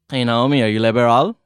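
"liberal" said loudly with misplaced pitch accent; wrong pitch accent. Shown as a demonstration. Teacher testing co-host with a mispronounced word, wrong pitch accent. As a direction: ask loudly, putting the wrong melody on "liberal". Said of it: "a completely different pitch accent".

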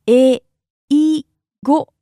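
"Eigo" slowly, each sound held apart; syllable by syllable. Shown as a demonstration. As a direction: break "Eigo" into three separate beats. Teacher breaks word into syllables.

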